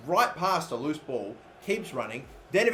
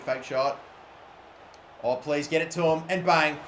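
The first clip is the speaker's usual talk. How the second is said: usual speaking voice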